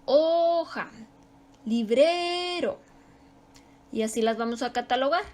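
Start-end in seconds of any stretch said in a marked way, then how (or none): 0.0-0.9 "Hoja" with a high, long HO; stress on HO. Shown as a demonstration. Teacher listing words by their accent, stress on HO. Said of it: "según el acento, la fuerza". 1.6-2.8 "librero" slow, with BRE high and held; stress on BRE. Shown as a demonstration. Teacher listing words by their strong tone, stress on BRE. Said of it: "ese tono fuerte".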